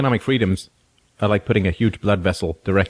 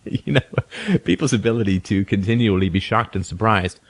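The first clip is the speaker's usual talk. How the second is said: ordinary talk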